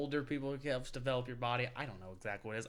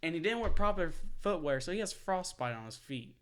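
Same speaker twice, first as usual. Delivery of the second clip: normal talking voice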